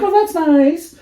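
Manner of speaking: high and sweet, mock-pleased; mock sweet texter. Quoting a scam texter, high mock sweetness. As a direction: coo it like a creepy stranger. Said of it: "they were like".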